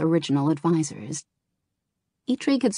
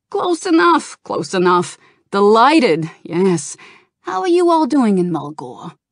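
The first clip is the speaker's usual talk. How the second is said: high, loud, excitable goblin; 4 semitones higher, 6 dB louder than usual. A narrator's reading. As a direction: chatter like a pushy goblin. Goblin Gallywix speaks.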